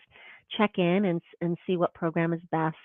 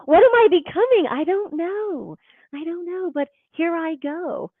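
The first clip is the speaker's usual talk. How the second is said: uncertain seed; 10 semitones higher, 5 dB louder than usual. Pretend.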